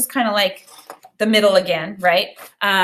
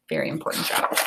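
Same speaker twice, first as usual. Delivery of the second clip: soft whispered aside; 7 dB quieter, faster than usual. Real, not acted. Teacher stressing the job's importance to students, in a whisper. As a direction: lean in and whisper it to the class.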